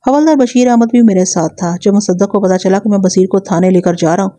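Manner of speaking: usual speaking voice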